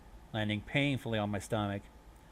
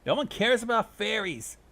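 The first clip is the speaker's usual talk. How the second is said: loud, high dismissive heckle; shouted jeer; 12 semitones higher, 7 dB louder than usual. A narrator's reading. Vampire-side pony jeering at fairies, high loud shout. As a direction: heckle loudly across the room. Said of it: "on a vampire side shouted".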